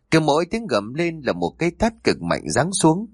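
ordinary delivery, nothing marked

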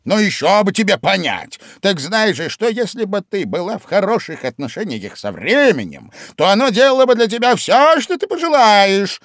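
high, loud, excited Hatter; a lively know-all Hatter; loud, high, bustling Hatter. A narrator's reading. Hatter explains time.

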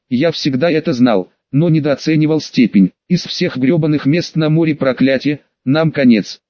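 ordinary delivery

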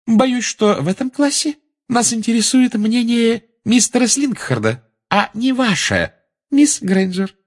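high, condescending put-down; stern teacher Umbridge. A narrator's reading. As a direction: correct the pupil in a high, deliberate voice. Teacher puts pupil in place. (высокий голос надменной преподавательницы)

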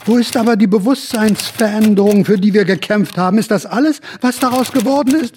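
high, pressing protest; high indignant outcry. Acted. The dreamer protests to the room, high and indignant. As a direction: demand it in rising outrage. Indignant question about lost change.

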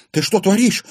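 high, loud, alarmed question; frightened. A narrator's reading. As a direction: cry out in sudden fright. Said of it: "перепугалась". (испуганно, высоким голосом)

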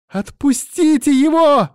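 high, loud desperate scream; shout. A narrator's reading. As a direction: scream at him to let go. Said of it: "закричала Вика".

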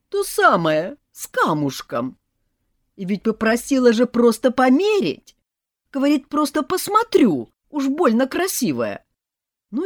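loud, high indignant complaint; mother complaining to son; aggrieved mother. A narrator's reading. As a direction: play the wronged victim.

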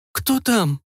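high, drawn-out drowsy voice; sleepy voice. Acted. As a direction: sound half-asleep at the door. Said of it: "как можно более сонным голосом протянула".

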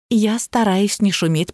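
usual speaking voice

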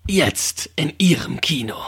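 slow whispered trailer echo. Pretend. Echoing the trailer line.

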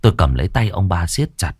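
ordinary delivery, nothing marked

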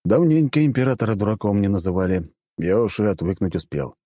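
ordinary delivery, nothing marked

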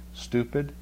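ordinary delivery

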